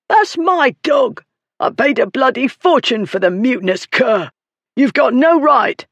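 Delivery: high, strained protest; furious, high-pitched ruffian; outraged bully. A narrator's reading.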